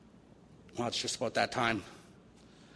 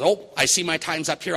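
lightly acted brisk brush-off, higher and louder; mock therapist sign-off, raised in pitch; psychiatrist ending session; 5 semitones higher, 7 dB louder than usual. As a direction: break off loudly as the time runs out. Psychiatrist abruptly ends the session.

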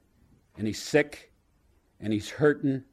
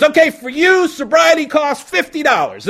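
much higher, loud salesman God; dealmaking God; 13 semitones higher, 9 dB louder than usual. Retold or imitated. God naming the price to the drunk, loud and high. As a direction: shout the price like a pushy merchant. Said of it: "And the guy goes".

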